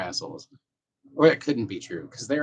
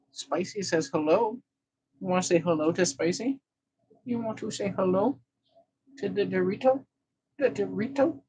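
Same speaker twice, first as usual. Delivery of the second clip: higher coaxing pet-talk voice; cat baby talk; 8 semitones higher than usual. Acted. Owner coaxing the cat to greet Spicy, cooing. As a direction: coo at the cat to say hello.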